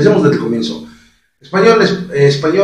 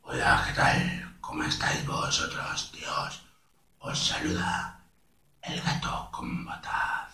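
slow, hushed, husky Spanish cat; El Gato con Botas; 8 semitones lower, 13 dB quieter than usual. Shown as a demonstration. Showing all steps to viewers, slow hoarse character voice. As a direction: breathe it out low and slow in Castilian. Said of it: "si le pones lo hablar lento".

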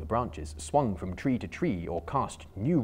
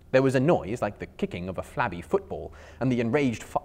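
plain reading voice

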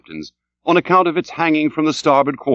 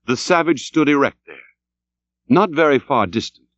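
ordinary delivery

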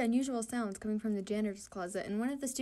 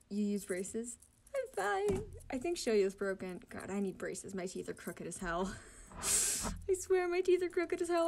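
usual speaking voice

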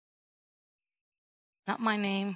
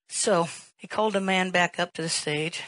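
ordinary talk, nothing marked

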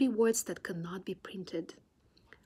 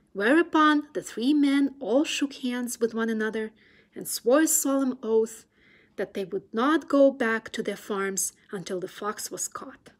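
ordinary delivery, nothing marked

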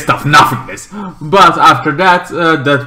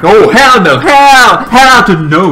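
loud, high, outraged outburst; emphatic refusal; 8 semitones higher, 8 dB louder than usual. Acted. Dean flatly refusing the plan, loud and emphatic. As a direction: punch each refusal loudly and high.

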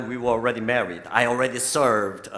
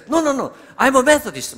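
high, loud, quick retold denial; raised-pitch puzzled rebuttal; puzzled protest; 12 semitones higher, 7 dB louder, faster than usual. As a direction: protest quickly, taken aback. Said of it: "I was really puzzled by her question".

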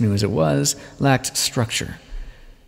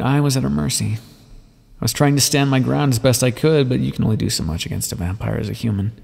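usual speaking voice

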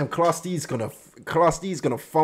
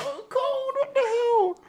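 very high, slow and stretched-out; high, stretched-out mock disbelief; squeaky outrage; much slower than usual. Real, not acted. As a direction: shriek it like a betrayed fan. Shocked by his coldness.

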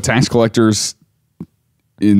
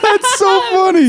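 much higher, playful put-on voice; mock cult member. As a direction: squeak it like a teasing cult member.

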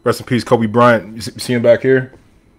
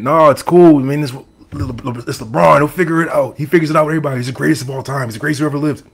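lightly mocked fan voice, pitched up; mimicking fans; 4 semitones higher than usual. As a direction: imitate fans brushing off doubts, a bit higher. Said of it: "A lot of people were coming at me saying".

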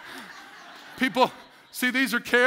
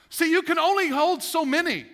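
ordinary delivery

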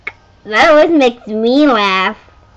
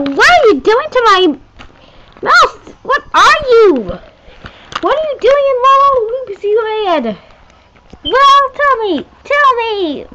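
alarmed demand; 10 semitones higher than usual. Acted. Doll confronting a stranger about her mouse, high alarmed demands. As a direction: demand answers in a high panicked voice.

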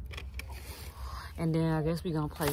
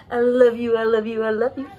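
louder, higher sing-song I love you; 3 semitones higher, 6 dB louder than usual. Real, not acted. Cooing to her crying baby cousin, louder and higher.

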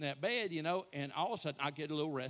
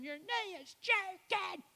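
much higher, slow, drawn-out call; wife's voice; slower than usual. Retold or imitated. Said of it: "I'm just hearing".

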